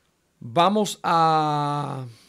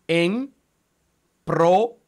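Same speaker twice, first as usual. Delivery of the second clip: loud, higher "En", pause, loud "pro"; syllables split; 5 semitones higher, 7 dB louder than usual. Shown as a demonstration. Teacher showing where to divide, "En" and "pro" loud and apart. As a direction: punch "En" and "pro" as two separate syllables. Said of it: "hay que separarla de esta manera".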